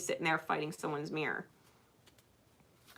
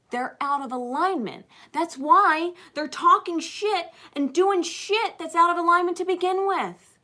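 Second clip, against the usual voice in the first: emphatic preaching; 9 semitones higher, 8 dB louder than usual. Acted. Emphatic preaching about alignment.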